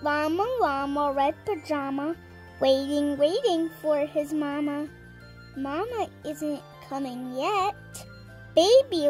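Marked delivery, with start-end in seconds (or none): none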